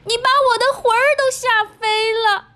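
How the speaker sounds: very high, startled wailing cry; hammed-up little old lady getting a fright; frightened old woman. A narrator's reading. Old woman startled by the lion, crying out high. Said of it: "瘦小的老妇人喊起来".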